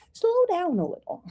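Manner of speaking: playfully soothing, high-pitched and lighter; playful slowing down. Shown as a demonstration. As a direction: stretch it out high, sliding down. Repeats card's words playfully, laughs after.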